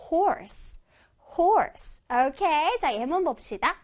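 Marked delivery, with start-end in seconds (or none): 0.1-2.0 "horse" with the or drawn out; long OR. Shown as a demonstration. Teacher showing children "horse" with a long or. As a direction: hold the or in "horse". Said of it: "or를 길게 발음해 줄 거야".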